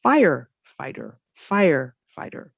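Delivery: firefighter with FIRE stressed, voice up on fire; stress on first word. Shown as a demonstration. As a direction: lean on fire in firefighter, then drop. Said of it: "the stress is on the first word".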